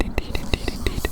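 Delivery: normal talking voice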